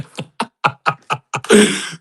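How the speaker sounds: high burst of laughter; a real high-pitched laugh; a laugh breaking out high. Real, not acted.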